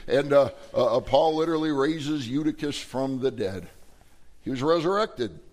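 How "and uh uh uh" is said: ordinary talk, nothing marked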